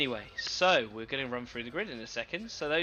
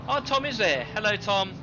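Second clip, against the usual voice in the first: excited greeting; 8 semitones higher, 5 dB louder than usual. Real, not acted. Spots a friend on grid.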